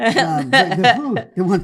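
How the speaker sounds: loud words through real laughter; laughing too hard to finish smoothly. Real, not acted. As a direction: struggle to talk while cracking up. Laughing over the dark joke.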